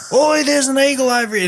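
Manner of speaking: high-pitched retold call to mates; excited mock call-out.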